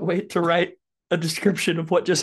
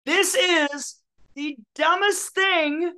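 exasperated; 9 semitones higher, 3 dB louder, slower than usual. Real, not acted. Exasperated rant about complaint.

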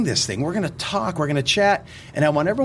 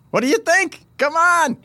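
excited; 12 semitones higher than usual. Real, not acted. Boasting about the jingle.

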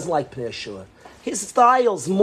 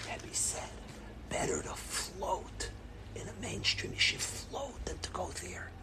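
whispering; 3 semitones higher, 21 dB quieter than usual. Retold or imitated. Mother whispering the Rebbe's ruling to the rabbi. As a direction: murmur the secret advice. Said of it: "she started whispering".

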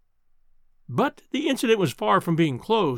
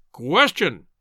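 a fuller, louder booming call; 2 semitones lower, 4 dB louder than usual. Acted. Professor heckles the lecturer again, a louder boom. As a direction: boom it out, bigger this time. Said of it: "boomed the voice once more".

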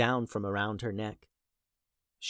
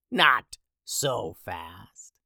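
slow, hissed witch whisper; eerie whisper; 3 semitones lower, much slower than usual. Acted. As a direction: whisper it like a crone. Soothsayer whispers in character.

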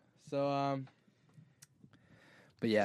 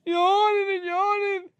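high, whining cry; whiny cry; 12 semitones higher than usual. Pretend. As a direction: whine it like a sulky kid. Whiny complaint.

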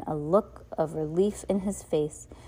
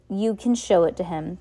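angry, louder; 5 dB louder than usual. Acted. Captain Sham cutting off the orphans, angrily louder. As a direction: voice rising in anger, louder. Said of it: "his voice rising in anger".

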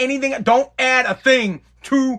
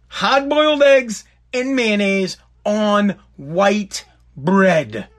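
slow, spelled out; slow deliberate; much slower than usual. Real, not acted. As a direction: lay out the recipe slowly.

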